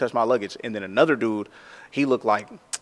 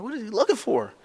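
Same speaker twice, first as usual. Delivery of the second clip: puzzled inner thought; 11 semitones higher than usual. Retold or imitated. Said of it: "I'm like".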